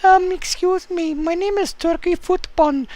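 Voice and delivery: high, soft odd character; silly character voice. Acted. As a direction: play a goofy stranger. Plays Turkey Foot character.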